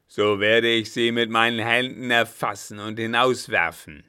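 loud, booming threat. Acted. Captain threatens to throw the German out, booming. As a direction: roar it across the room. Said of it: "mit dröhnender Stimme".

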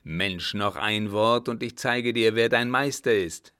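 loud, stern menace; threaten, building in force. Acted. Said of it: "erhob die geballte Faust und drohte".

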